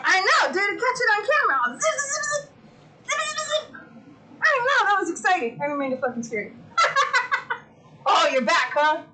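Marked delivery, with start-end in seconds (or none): 1.8-3.8 high playful zip sounds; zapper noises. Real, not acted. Streamer imitating her fly zapper, high playful zips. 6.8-7.6 laugh. Real, not acted.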